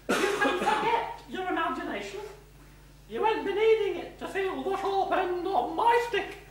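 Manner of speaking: high, shrill-free bossy matron voice; stern matron. Acted. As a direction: scold like a strict school matron. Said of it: "How dare you make me sound like a parrot?".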